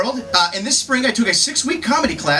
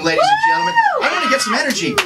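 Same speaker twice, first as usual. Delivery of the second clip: hype shout; faster than usual. Real, not acted. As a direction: whip the room up, yelling. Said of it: "I want some energy in this room".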